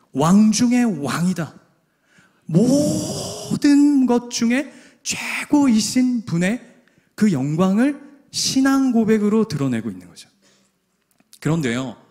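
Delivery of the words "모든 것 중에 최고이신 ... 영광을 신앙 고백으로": slow, higher, drawn-out proclamation; preaching emphasis. Acted. Preacher exalting Jesus before the congregation. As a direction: lift it up slowly in praise.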